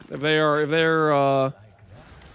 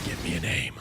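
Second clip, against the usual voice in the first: much lower, softer, sinister demand; menacing villain; 14 semitones lower, 11 dB quieter than usual. Pretend. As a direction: growl it like a mob boss.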